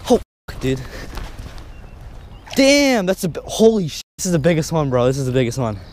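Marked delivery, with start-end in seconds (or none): none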